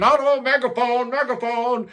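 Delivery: high sung-ish imitation of the vocalist; imitating the singer. Reviewer mimics the album's singer, high and tinny. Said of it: "Sounds like [name] might be singing".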